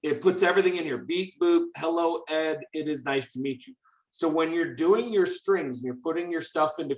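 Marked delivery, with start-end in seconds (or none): none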